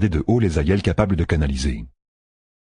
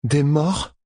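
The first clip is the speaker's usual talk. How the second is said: insisting; 9 semitones higher than usual. Acted. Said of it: "insista Egwene".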